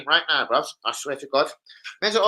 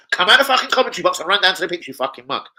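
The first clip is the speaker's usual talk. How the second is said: ordinary delivery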